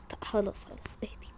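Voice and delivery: soft whispered shushing; calm her down in a whisper; gentle whispered hush. Acted. Hushing friend.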